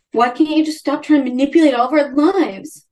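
high, pinched sneer of an annoyed brother; mocking whiny brother. Brother snapping at Jess at the family table, in a high mocking whine. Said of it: "And Steven's like".

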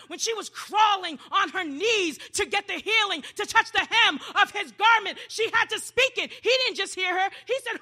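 high, loud, driving exhortation; impassioned preaching. Real, not acted. Preacher urging the church to be desperate and speak, high and loud. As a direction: build it with forceful high shouts. Preacher urges congregation at full force.

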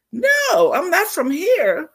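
high-pitched, imitated protest; mock bewilderment. Nurse to the joking visitor, imitated bewilderment. Retold puzzled nurse answering the joke.

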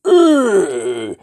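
high, loud ma of disbelief; stunned cry. Shown as a demonstration. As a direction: cry out, can't believe your eyes. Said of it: "like you can't believe your eyes".

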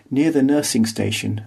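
usual speaking voice

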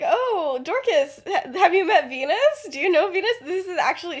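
high, loud, gushing chatter; overeager introduction. Acted. Lily showing Venus off to Dorcas, overeager and high. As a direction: introduce her far too keenly.

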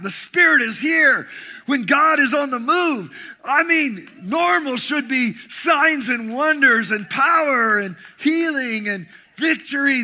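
high, loud, emphatic sermon peak; fiery preaching. Acted. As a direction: preach it with full fire. Preaching at full force.

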